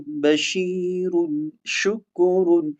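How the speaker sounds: بشیر and شکر drawn out syllable by syllable; slow teacher demonstration of بشیر شکر; very slow example words. Shown as a demonstration. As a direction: model بشیر and شکر slowly to the learners. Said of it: "مثال سے آپ سمجھیے".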